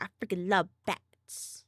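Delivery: put-on loud, emphatic little-girl quote; little girl from TikTok. Quoting the TikTok girl who loves bats, imitated little girl. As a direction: blurt it loudly, imitating an excited kid. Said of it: "where the little girl's like".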